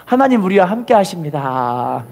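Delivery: slow, drawn-out final syllable; low greeting stretched out at the end; congregational greeting. Shown as a demonstration. Preacher has the congregation greet one another, drawn-out greeting. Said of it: "인사합시다".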